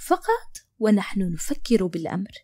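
ordinary delivery, nothing marked

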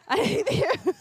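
high, loud words breaking up in laughter; laughing. Real, not acted. Comic laughing at her son's mispronounced word, laughing. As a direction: crack up while repeating the line.

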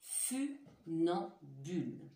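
Funambule stretched very slowly, syllables separated; slow careful word. Shown as a demonstration. Teacher repeats funambule slowly for careful listening. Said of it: "écoutez bien".

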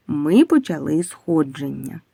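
ordinary delivery

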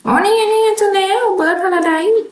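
high, slow, delighted aha; drawn out, thrilled to have found it; excited find. Real, not acted. Spotting the Bird Paradise piece at last, a high delighted drawl. Finally finds the missing item.

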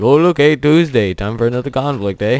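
loud, high mock Canadian accent; hammed-up Canadian cheer, loud and high. Shrugging off another conflict with a jokey Canadian accent. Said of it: "I don't know why that was Canadian".

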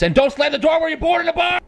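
high-pitched shouted warning; mock yelled warning. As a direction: yell it as a parting warning.

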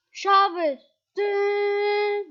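high sung chime sound; sing-song chime. Acted. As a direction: chime it out like a game jingle. Imitating a shower chime.

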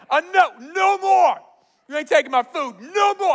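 high, loud battle protest; pumped up. Retold or imitated. Gideon fed up with food being taken, pumped up. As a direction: roar your refusal, fired up. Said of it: "he's so pumped up".

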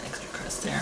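ordinary talk

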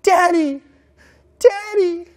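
put-on high, loud child's cry for Daddy; imitating a screaming child. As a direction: call out like a hurt three-year-old reaching for his dad. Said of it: "And he is screaming".

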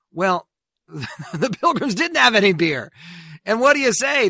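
chuckling through the line, voice up high; laughing. Real, not acted. Host amused telling listeners about the beer, laughing. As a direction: laugh it off as you speak.

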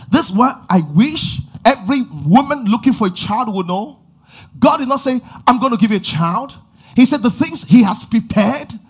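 loud, high, driving preacher's build; impassioned sermon climax, rising to a shout; full-force preaching. Real, not acted. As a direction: preach it hard and rising to the crowd.

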